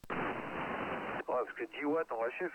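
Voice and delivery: usual speaking voice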